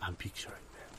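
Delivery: hushed, breathy, mock-dreamy whisper. Accountant playing along with his partner's grand vision, whispering.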